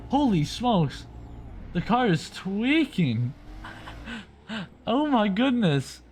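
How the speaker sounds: high, stunned exclamation; startled. Real, not acted. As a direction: caught off guard by the glitch. Car glitching during drive.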